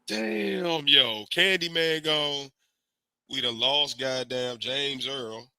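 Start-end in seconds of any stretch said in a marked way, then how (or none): none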